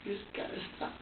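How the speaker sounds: hushed, pleading whisper; retold whisper. Father retelling how he whispered to his crying son. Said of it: "I was like, I was like".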